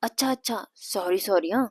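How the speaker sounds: soft, hushed apology; low voice. Acted. Priya apologising after being hushed, in a low voice. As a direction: say sorry under your breath. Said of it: "Priya हळू आवाजात".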